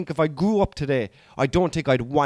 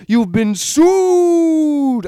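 high, loud, very drawn-out last word; solicitor's victory cry; 10 semitones higher, 7 dB louder, much slower than usual. Retold or imitated. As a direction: gloat in a long celebratory yell. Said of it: "ran over to the other solicitor".

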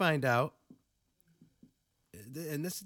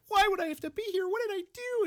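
put-on high, louder innocent girl voice; mock girl voice; 4 dB louder, faster than usual. Retelling the girl's question to the detective, high and innocent. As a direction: plead innocence in a high girlish voice. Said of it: "she's like".

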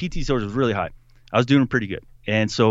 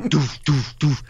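spoken "doof" thuds, evenly spaced; slow, weighty stomping noises; imitated heavy footfalls; 4 semitones higher, slower than usual. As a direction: mimic a big man pounding along.

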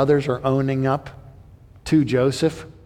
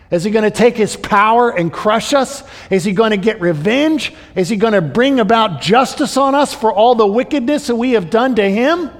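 preaching; 6 semitones higher, 5 dB louder than usual. Acted. Preaching builds to a peak.

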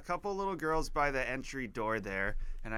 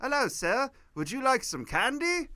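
put-on high, polite little-girl voice with British accent; lilting high-pitched English schoolgirl; 10 semitones higher than usual. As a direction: imitate a sweet British child, pitched way up. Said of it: "this little girl's like".

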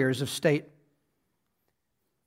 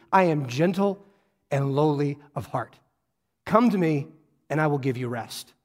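gentle invitation; 5 semitones lower than usual. Retold or imitated. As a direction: offer rest tenderly, like an open hand.

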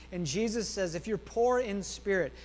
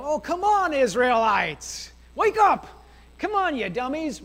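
high, loud mock scolding; exasperated shout; 6 semitones higher, 8 dB louder, slower than usual. Preacher yelling at the Israelites while reading Old Testament, exasperated shout. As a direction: yell in hammed-up frustration at a hopeless bunch.

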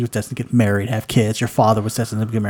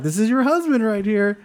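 teasing matchmaker; 9 semitones higher than usual. Retold or imitated. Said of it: "like, [name], like".

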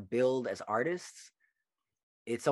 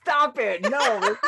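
high, loud, playful objection; laughing protest; 13 semitones higher, 8 dB louder, much slower than usual. Real, not acted. Host teased about British accent, laughing protest. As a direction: protest loudly through laughter.

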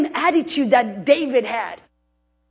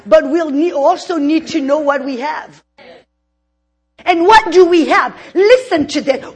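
ordinary talk

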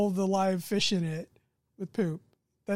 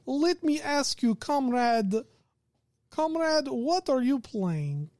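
slow, higher Russian comrade voice; mock Russian accent; 4 semitones higher, slower than usual. Game master questioning a comrade player, mock Russian accent. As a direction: draw it out in a put-on Russian accent.

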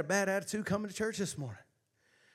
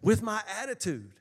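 loud, rousing sermon delivery; preaching; 2 semitones higher, 8 dB louder than usual. Acted. Pastor rousing the church to give God glory, loud preaching. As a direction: preach it out to the congregation.

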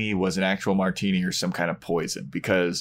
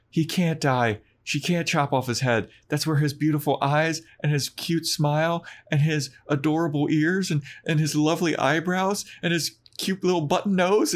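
higher, lovestruck gushing run; a hint of lovestruck Willow gushing; 8 semitones higher than usual. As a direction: swoon over every feature. Willow gushes over Xander.

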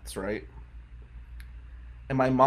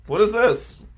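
surprised question; 9 semitones higher, 7 dB louder than usual. Retold or imitated. Confused at the menu.

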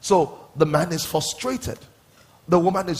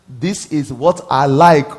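slow, loud, every word spelled out; emphatic hint; 9 dB louder, slower than usual. Pretend. Pastor voices a wife spelling out her wish, slow and loud. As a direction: make him hear it, word by word. Voicing a wife hinting at gifts.

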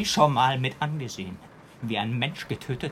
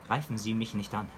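usual speaking voice

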